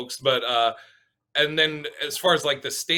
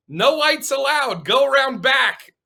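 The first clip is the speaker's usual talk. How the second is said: much higher, louder and slow, like a bouncer's order; mock stern sign voice; 11 semitones higher, 7 dB louder, slower than usual. Reading out an imagined door sign, high, loud and slow. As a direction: bark out the rule slowly, high and loud. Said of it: "a sign above her vagina that said".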